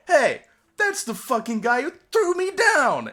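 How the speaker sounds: loud, high, drawn-out cartoon shout; hammed-up outraged accusation; indignant reptile. Reptile spotting who threw it down, indignant cartoon shout.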